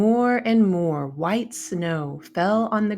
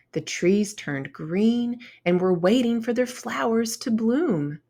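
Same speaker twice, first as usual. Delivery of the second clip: plain reading voice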